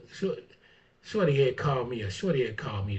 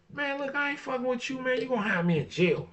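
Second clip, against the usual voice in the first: pretend telling off; 7 semitones higher than usual. Acting out telling someone off.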